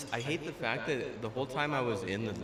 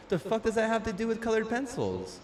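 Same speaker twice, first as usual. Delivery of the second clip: higher, incredulous rant; outraged high voice; 10 semitones higher than usual. Retold or imitated. Mocking the class assignment, he rants in a higher outraged voice. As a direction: squeak out the disbelief. Outraged about colored pencils.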